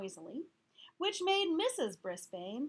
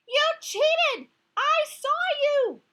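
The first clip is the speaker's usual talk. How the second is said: furious high shout, much louder; yelling; 16 dB louder, slower than usual. Acted. Gail yelling at Heidi that she cheated, furious. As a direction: scream the accusation at her friend. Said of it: "she yelled".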